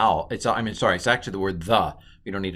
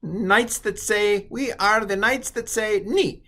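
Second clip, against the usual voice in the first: high, slow, hammed-up knight voice; Knights who say Ni; 12 semitones higher, slower than usual. Teacher doing a comic knight riffing on the word ni. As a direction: drone it out like a silly knight. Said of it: "The accents on the ni".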